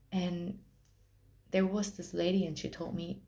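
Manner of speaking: ordinary talk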